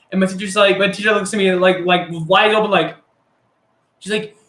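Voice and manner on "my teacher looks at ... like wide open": ordinary delivery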